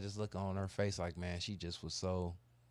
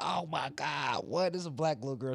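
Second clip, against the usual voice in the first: put-on shocked kids; 6 semitones higher, 3 dB louder than usual. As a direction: stretch out the disbelief. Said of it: "They like".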